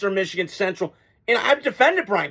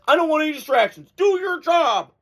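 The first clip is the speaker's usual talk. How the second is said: fast, higher mock Belichick order; imitating Belichick; 6 semitones higher than usual. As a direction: lightly parody the coach's curt command. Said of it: "he says".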